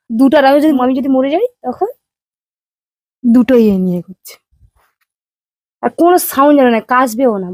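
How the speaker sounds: stern warning. Real, not acted. Wife lays down rules before sleeping.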